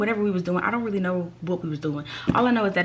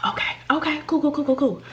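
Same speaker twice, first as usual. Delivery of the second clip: whispered self-calming; 6 semitones higher than usual. Retold or imitated. Shaken self-soothing whisper.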